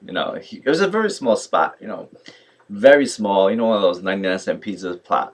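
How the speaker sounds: ordinary delivery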